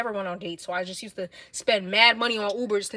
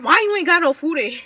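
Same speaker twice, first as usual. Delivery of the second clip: higher, louder mock yell at him; retold yelling; 7 semitones higher, 10 dB louder than usual. Yelling at the boyfriend about the empty fridge. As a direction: replay the scolding, loud and high. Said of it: "I literally used to yell at him".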